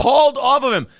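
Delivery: high, loud disbelief; incredulous echo. Real, not acted. Host catches a detail in the news report, loudly incredulous. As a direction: pounce on the reporter's words, indignant.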